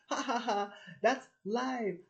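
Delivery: high, loud fake ha-ha-ha; mocking laughter. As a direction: jeer in a high put-on voice. Acting out people mocking them.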